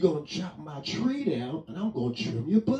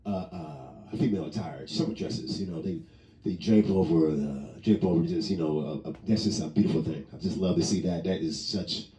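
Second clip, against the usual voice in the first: a hammed-up lover-man voice savoring summer dresses; smooth seductive voice; 9 semitones lower than usual. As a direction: purr it like a smooth ladies' man. Admiring sundresses in lowered voice.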